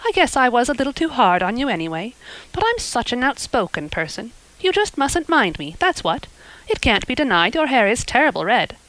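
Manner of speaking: put-on motherly neighbour forgiving a child, bluntly; hearty Mrs Lynde. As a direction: talk like a blunt, kindly busybody. Mrs Lynde voiced in story.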